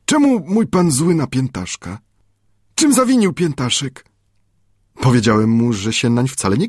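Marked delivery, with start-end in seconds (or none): 0.0-4.0 high, lightly voiced sorrowful native boy; a hint of a hurt servant pleading, pitched high; plaintive Friday. A narrator's reading. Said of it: "rzekł żałośnie". (żałośnie, wysokim głosem Piętaszka)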